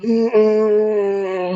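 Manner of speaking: high, loud hurt oh; pained groan. Retold or imitated. Lying on the floor after the crash, pained groan.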